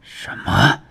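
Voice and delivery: halting, shocked question; aghast disbelief. A narrator's reading. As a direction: gasp out the question in alarm. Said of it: "唐庆大骇".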